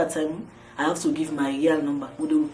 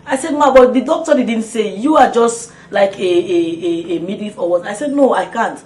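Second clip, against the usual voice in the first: loud, higher, heated back-and-forth; retold shouting match; 4 semitones higher, 11 dB louder than usual. Mother and nurse shout over the operation, loud and high. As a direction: argue at full volume. Heated argument in labor ward.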